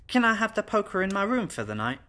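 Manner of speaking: high, put-on child voice; little girl. Little girl asks governess for the poker, high childish plea. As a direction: ask hopefully as a small child.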